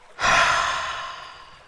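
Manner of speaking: long, audible breathy exhale; demonstrative deep sigh out. Shown as a demonstration. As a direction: let out a big releasing sigh. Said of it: "sighing out".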